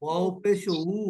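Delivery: উ in ওয়াও পেশ উ drawn out slowly with no jolt; smooth u. Shown as a demonstration. Teacher models ওয়াও পেশ উ without a jolt. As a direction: glide into উ, no push. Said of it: "ধাক্কা হবে না".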